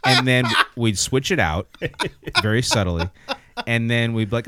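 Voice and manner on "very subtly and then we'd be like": usual speaking voice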